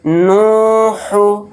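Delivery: نو held long, ہو short; long نو then quick ہو; stretch the first syllable. Shown as a demonstration. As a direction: draw out نو, snap ہو. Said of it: "اس میں صرف نون کو کھینچنا ہے".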